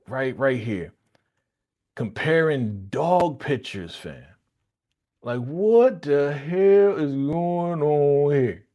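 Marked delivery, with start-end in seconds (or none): 5.2-8.7 slow, louder disbelief; incredulous. Real, not acted. Vlogger reacting to the episode in loud disbelief. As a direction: drag out the outrage. Reacting to dog pictures scene.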